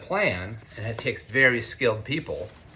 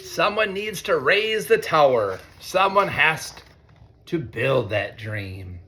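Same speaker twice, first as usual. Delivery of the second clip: slow, higher refrain, dropping on the dream; reading to children; 4 semitones higher, slower than usual. A narrator's reading. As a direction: lean into the refrain slowly. Reads picture book to students.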